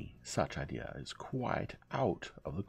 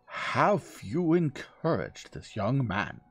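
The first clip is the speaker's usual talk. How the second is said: loud, high, very slow accusation; awful, stern demand; 8 semitones higher, 10 dB louder, much slower than usual. Acted. Old lady interrogating her young cousin, awful stern voice. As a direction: stretch each word out in outraged force. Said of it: "her voice was truly awful in quality".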